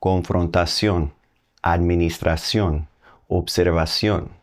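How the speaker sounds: Confrontación, administración, observación said with Spanish vowels and stress on the final ón; crisp Spanish demo of the cognates; Spanish pronunciation. Shown as a demonstration. Teacher showing learners how Spanish sounds. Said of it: "let's hear what those words sound like".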